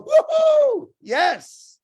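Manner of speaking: imitating overjoyed onlookers, high and loud; mock cheering. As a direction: play the crowd who thinks it won the jackpot. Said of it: "which for many people go".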